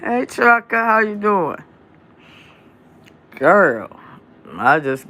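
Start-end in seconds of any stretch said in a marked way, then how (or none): 0.0-1.6 high, friendly call-out to a viewer; greeting a viewer, pitched up; bright greeting. Real, not acted. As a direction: sing out a hello to someone joining.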